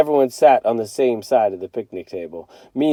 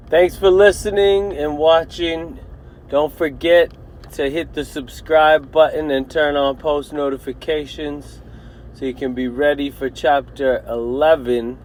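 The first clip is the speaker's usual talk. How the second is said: outro; 2 semitones higher than usual. Real, not acted. Channel outro.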